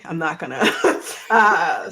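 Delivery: laughing through near tears; teary laugh. Real, not acted. Laughing to the cast so as not to cry. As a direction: laugh it off, fighting back tears. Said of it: "I'm trying not to cry".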